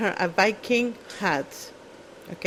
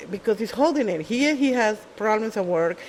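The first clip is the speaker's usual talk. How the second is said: usual speaking voice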